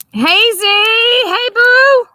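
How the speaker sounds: high, loud hey boo; calling out greeting. Real, not acted. Streamer greeting viewers joining the live, high and loud. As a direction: call it out high and bright.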